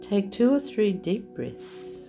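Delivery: ordinary talk, nothing marked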